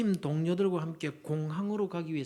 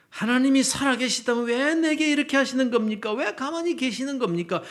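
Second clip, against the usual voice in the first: loud, high acted protest to God; anguished outcry; 7 semitones higher, 8 dB louder than usual. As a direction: cry out like someone demanding answers from God. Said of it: "이렇게 울부짖을 사람들이". (울부짖듯 높고 크게 따지는 소리)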